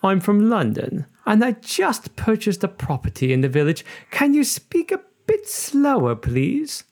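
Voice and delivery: high-pitched Londoner, badly faked accent. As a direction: put on a hammed-up London accent for a joke. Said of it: "this joke because it requires an accent".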